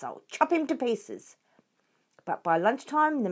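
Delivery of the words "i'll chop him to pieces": shouting. A narrator's reading. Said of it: "shouted Bunce".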